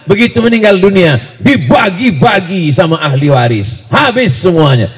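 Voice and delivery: loud, high-pitched sermon shout; full-force preaching. Real, not acted. Preacher to congregation on endowed land versus private land, impassioned shouting. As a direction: drive it home at the top of your voice.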